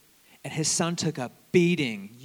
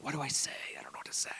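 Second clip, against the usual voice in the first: whispered, unsure what to say; anxious whisper; 11 dB quieter, much faster than usual. Retold or imitated. Diner stuck for words, whispering anxiously. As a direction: hush it like a nervous guest.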